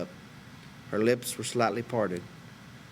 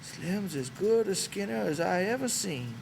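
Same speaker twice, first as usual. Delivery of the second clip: put-on higher, slower old swamper, hesitant and embarrassed; a shy old ranch hand easing into small talk; Candy's old man voice; 10 semitones higher than usual. A narrator's reading. Candy changes the subject.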